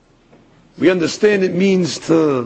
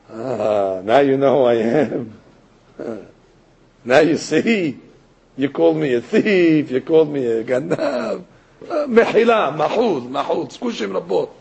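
much lower, mock gloating voice; a lowered imitation of the rabbi gloating; imagined gloating rabbi; 6 semitones lower than usual. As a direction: gloat low like a wronged rabbi. Imagined rabbi mocking the man.

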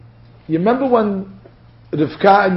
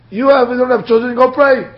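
dismissive retort; 6 semitones higher than usual. Acted. Acts out Yaakov rebuffing Rachel.

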